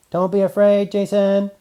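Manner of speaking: loud, high call. Acted. Robot Man calls across the yard to Jason, loud. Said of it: "Robot Man called out".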